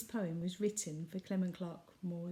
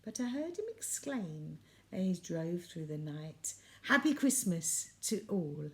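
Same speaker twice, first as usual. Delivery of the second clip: lilting storybook reading of a Christmas poem; poem recital; 2 semitones higher, 4 dB louder than usual. A narrator's reading. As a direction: read it like a bedtime Christmas poem for the family. Reads Christmas poem to listeners.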